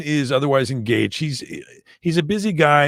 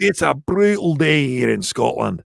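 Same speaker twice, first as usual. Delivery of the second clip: hammed-up Scottish accent; playful Glaswegian accent; 3 semitones higher than usual. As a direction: play a Scot with a thick put-on accent. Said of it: "do all of his accents".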